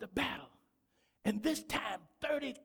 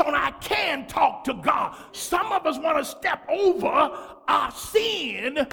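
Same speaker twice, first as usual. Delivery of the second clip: preaching at full force; 2 semitones higher, 9 dB louder than usual. Acted. Pastor pressing the congregation on sin, preaching full force. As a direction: hammer the verse home to the church.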